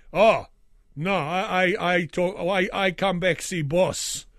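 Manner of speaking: put-on loud generic European accent, fending off the shopkeeper. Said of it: "generic European accent".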